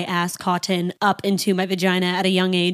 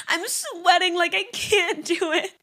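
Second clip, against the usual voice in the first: higher, pleading panic; squeaky half-laughing distress; retold panic; 7 semitones higher than usual. Begging mom outside the door, higher panicked plea.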